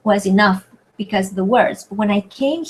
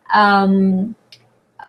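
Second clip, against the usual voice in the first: usual speaking voice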